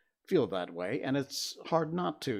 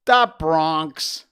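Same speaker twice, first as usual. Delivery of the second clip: loud, drawn-out "da Bronx"; Bronx accent; 5 semitones higher, 13 dB louder than usual. Pretend. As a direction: boom it out in a hammed-up Bronx accent. Showing off Bronx shirt, hammed accent.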